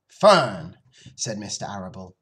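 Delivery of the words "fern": loud, falling name; stern father. Acted. Father checks his daughter grabbing the axe, stern and loud. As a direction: cut in firmly with the name.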